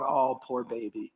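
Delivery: gently mock the whiners; mock pity. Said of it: "and going".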